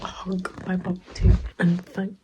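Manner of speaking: hushed late night whisper. Real, not acted. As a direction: whisper so no one wakes.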